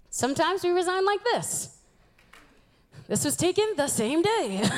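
ordinary talk

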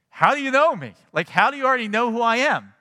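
high, loud, amazed question; bewildered that he is known; high and loud with disbelief; astonished. Retold or imitated. Nathanael astonished at Jesus.

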